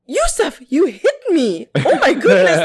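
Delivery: high, prim mock gasp of shock; mock prissy victim. Mocking a witness on the stand, high and prim. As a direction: play it as a prissy, overdone victim. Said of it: "I'm not gonna be like".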